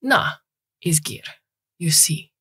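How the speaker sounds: put-on light Eastern European old man's accent; a hint of a gruff Eastern European repairman. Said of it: "a thick Eastern European accent".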